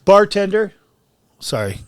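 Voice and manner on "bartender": loud, high call to the bartender. Pretend. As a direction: holler across the bar for service. Calling out for bar service.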